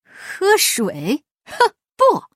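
scoffing, then a loud high "不"; gloating refusal. Acted. Guan gloating as he refuses Afanti water, scornful. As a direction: sneer and refuse. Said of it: "关老爷得意忘形地说".

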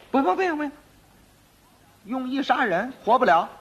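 high-pitched, insistent refusal; officers refusing. Acted. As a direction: rattle off a firm official no. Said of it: "二位头说".

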